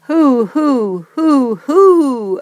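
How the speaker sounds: high, loud repeated hoots; owl call. A narrator's reading. Owl calling again and again at the dragon. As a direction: hoot urgently into the dark. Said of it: "Owl called".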